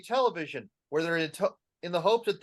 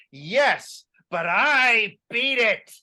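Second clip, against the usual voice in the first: triumphant boast; 4 semitones higher, 6 dB louder, slower than usual. Acted.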